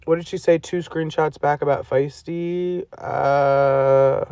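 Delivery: usual speaking voice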